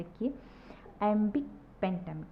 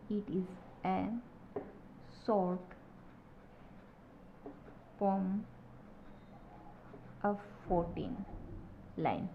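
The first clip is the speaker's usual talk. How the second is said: dictation; 6 dB quieter, much slower than usual. Shown as a demonstration. Teacher dictating notes.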